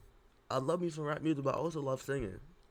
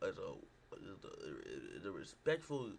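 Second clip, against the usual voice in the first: stuck repeating the same words; stumbling over the words; stuttering; 5 semitones lower, 4 dB quieter than usual. Real, not acted. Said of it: "I'm sorry for the stuttering".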